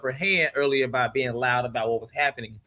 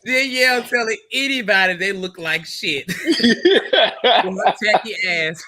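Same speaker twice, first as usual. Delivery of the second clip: louder, slow laughing jab; laughing mockery; 4 semitones higher, 6 dB louder than usual. Real, not acted. Cohost mocking Danielle's outfit, laughing loudly. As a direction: drag her while cracking up.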